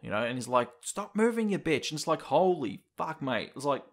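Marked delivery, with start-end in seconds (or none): 0.8-1.9 high-pitched imitated snarl at the student; mock angry snarl. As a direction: snap it out like the villain teacher, half-acted. Voicing abusive teacher in game.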